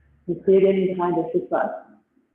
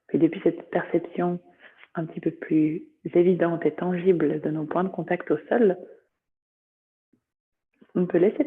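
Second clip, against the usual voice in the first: calm yoga nidra guidance, long pauses between phrases; guided meditation. Acted. Teacher guiding students through body sensations, meditative voice.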